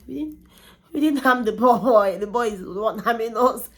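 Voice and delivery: retold plea of innocence, rising loud; mock protest. Retells protesting innocence to nurse.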